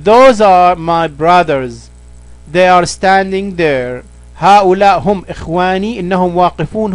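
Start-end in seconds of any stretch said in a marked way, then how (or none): 0.0-4.0 slow, careful reading of the English example. Shown as a demonstration. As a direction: read each word slowly for the learner. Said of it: "هنستخدم those". (قراءة بطيئة وواضحة للمثال)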